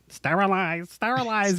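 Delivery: high-pitched robotic repeat of "Sterilize"; lightly voiced malfunctioning robot; high computer voice. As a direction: play a little computer that's losing it. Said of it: "his little computer voice gets high-pitched".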